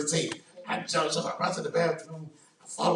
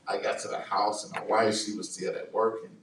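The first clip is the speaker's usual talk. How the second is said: ordinary talk, nothing marked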